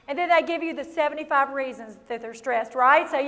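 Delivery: ordinary delivery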